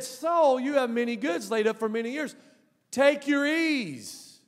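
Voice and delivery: ringing, high recitation; declamatory quoting. Acted. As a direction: proclaim the rich man's boast, stretching the last phrase. Rich man's words preached again, louder.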